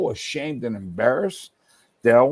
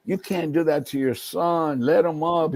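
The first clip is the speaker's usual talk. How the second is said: put-on higher-pitched appeal on a child's behalf; pleading grandfather; 6 semitones higher than usual. Grandfather defending grandson to his father, pleading. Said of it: "Always was there to defend me".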